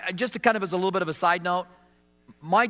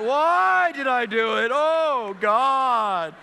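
put-on child's wailing; 4 semitones higher, much slower than usual. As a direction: wail it like a sorry child. Child wailing in his room.

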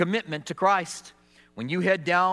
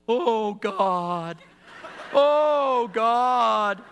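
put-on child's wailing; 4 semitones higher, much slower than usual. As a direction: wail it like a sorry child. Child wailing in his room.

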